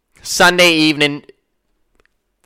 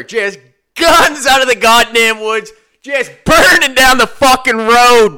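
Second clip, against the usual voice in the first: higher, louder, slow amazed build; excited telling; 8 semitones higher, 6 dB louder, slower than usual. Real, not acted. The narrator telling listeners of the drunk's ATV, loud and amazed. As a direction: hammer out the wild ATV escape.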